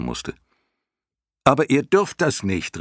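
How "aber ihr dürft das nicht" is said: high, loud protest; calling out. A narrator's reading. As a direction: call out an objection, pitched well up. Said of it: "rief er". (laut rufend)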